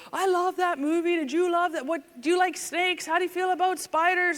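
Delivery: high-pitched, gushing retold enthusiasm; excited movie fan. Imitated hunter gushing to the cop about a movie, excited.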